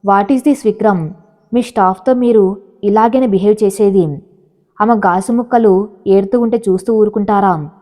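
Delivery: ordinary delivery, nothing marked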